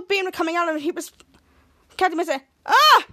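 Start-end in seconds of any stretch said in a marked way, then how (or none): 2.6-3.0 short scream, higher and louder; high-pitched scream. Retold or imitated. Hero hit by the beam, high-pitched scream. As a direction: yelp out in a high voice. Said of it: "It's screaming in a high-pitched voice".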